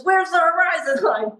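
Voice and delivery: high-pitched, exasperated demand; retold agitated question, pitched well up; upset. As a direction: imitate an upset director losing patience. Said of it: "he gets all upset and he says".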